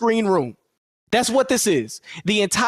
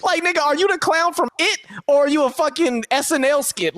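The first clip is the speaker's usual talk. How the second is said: ordinary delivery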